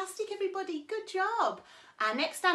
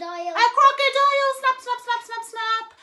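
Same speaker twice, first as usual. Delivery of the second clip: much higher and louder with glee; excited reveal; 11 semitones higher, 14 dB louder than usual. Acted. Teacher cheering the crocodile reveal to kids. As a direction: burst out with the answer.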